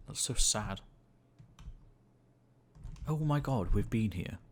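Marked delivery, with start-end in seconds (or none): 3.1-4.4 surprised exclaim. Real, not acted. Finds his earlier spot, surprised.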